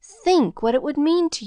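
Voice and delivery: a mother pleading with her son to go; quavering plea. A narrator's reading. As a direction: beg him to reconsider. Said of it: "quavered Missus Murphy".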